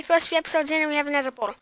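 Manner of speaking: ordinary talk, nothing marked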